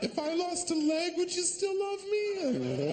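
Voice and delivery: hammed-up clingy girlfriend asking; needy woman. Comic voicing a needy girlfriend, high slow plea.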